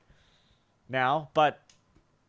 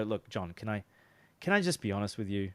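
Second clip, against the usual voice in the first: softer, quieter, get real with him; come-to-Jesus tone; 5 semitones lower, 4 dB quieter than usual. Shown as a demonstration. Salesman leveling with prospect John, softer and sincere. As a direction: drop the hype and level with the prospect. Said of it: "the come to Jesus tone".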